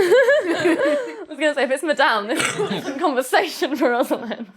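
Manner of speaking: loud laughing talk. Real, not acted. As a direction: laugh through the words. Laughing at the accent.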